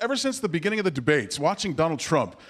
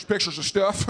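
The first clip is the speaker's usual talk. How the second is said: George W. Bush. Retold or imitated. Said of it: "when they ask him about that".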